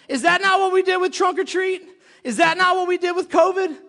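loud, high motivational preaching; full-force preaching. Real, not acted. Preacher telling congregation to push back, forceful. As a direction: fire up the congregation like a hard coach.

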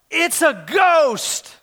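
drawn-out, high-pitched mock cry of fear; fearful cry. A narrator's reading. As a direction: cry it out slowly in dramatised alarm. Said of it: "they cried out".

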